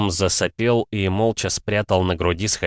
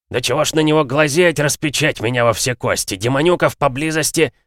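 grumbling dwarf, higher and louder; gruff gravelly dwarf voice; 7 semitones higher, 4 dB louder than usual. Acted. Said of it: "Заворчал гном".

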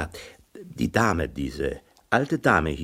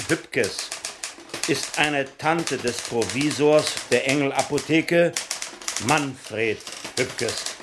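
dictating copy; 7 semitones higher, 4 dB louder than usual. Acted. Editor dictating the death report to be typeset. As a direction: dictate a news report, speaking punctuation aloud.

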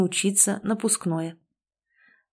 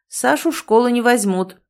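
plain reading voice

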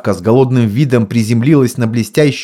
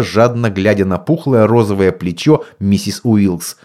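usual speaking voice

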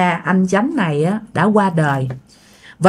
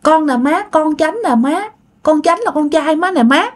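female voice; 8 semitones higher than usual. Retold or imitated. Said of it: "cái giọng thì giọng nữ nha".

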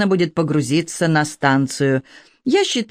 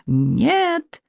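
much higher, desperate cry of no; anguished cry; 8 semitones higher than usual. A narrator's reading. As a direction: cry out in terror from upstairs. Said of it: "душераздирающий гортанный крик". (отчаянный, гораздо более высокий крик ужаса)

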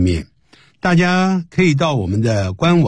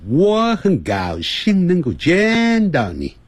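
我很高兴能够见到你 slow and high, vowels stretched long; 我很高兴能够见到你 with long, swooping, elongated words; drawn-out English timing; 6 semitones higher, slower than usual. Shown as a demonstration. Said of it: "它都拉得很长".

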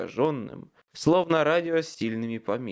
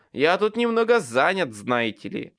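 strained sarcasm; 4 semitones higher, 6 dB louder than usual. A narrator's reading. Strained wizard snaps back.

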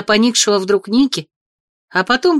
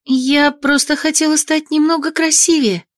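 put-on upset girl; 7 semitones higher than usual. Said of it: "встрепенулась расстроенная девушка".

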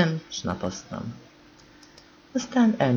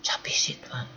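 soft hushed whisper; 5 dB quieter than usual. Acted. Márta whispering to Bede over the sleeping boy, hushed. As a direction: whisper so the sleeper doesn't hear. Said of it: "suttogta Márta".

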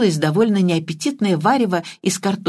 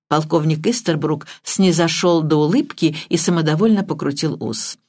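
plain reading voice

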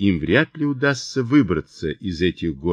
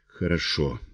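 soft, lower, feeble reply; weak voice; 4 semitones lower, 8 dB quieter than usual. A narrator's reading. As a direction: answer faintly, like a wounded man. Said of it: "его слабый голос". (слабым, тихим голосом)

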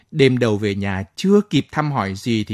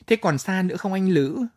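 shy giggly question; 7 semitones higher than usual. Acted. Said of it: "cười lòn lẻn hỏi".

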